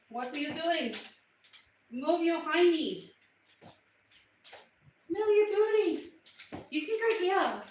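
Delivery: high-pitched coaxing; pet talk. Real, not acted. To pet on her chair, playful high coaxing. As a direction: coo and chide like talking to a pet.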